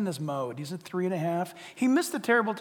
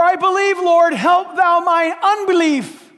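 higher, loud, slow plea; desperate cry; 10 semitones higher, 12 dB louder, slower than usual. Acted. Believer begging God for help, loud and slow. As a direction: beg the Lord, loud and drawn out.

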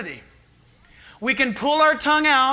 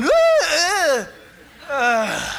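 a higher woo fading into a sick groan; queasy woo; 4 semitones higher, 4 dB louder, much slower than usual. Retold or imitated. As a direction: cheer turning to nausea. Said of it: "And then I'm going".

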